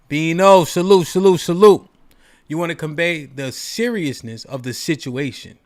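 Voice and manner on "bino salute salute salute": loud sharp urgent command; urgent shout. Shown as a demonstration. Calling the dog, loud urgent command. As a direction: bark it out to grab attention now. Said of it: "Not only do I raise my voice, but I change my tone to an urgent one".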